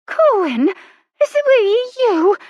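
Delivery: high, loud and slow indignant lady's voice; indignant countess. A narrator's reading. Countess muffled behind Colin's hand, high and indignant. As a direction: demand it through a hand over the mouth. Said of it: "came indignantly from behind Colin's hand".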